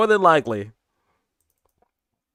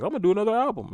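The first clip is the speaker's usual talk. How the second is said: higher, bright-eyed declaration; excited self-quote; 10 semitones higher, much faster than usual. Retold or imitated. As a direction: announce it up high, full of plans. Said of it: "I was like".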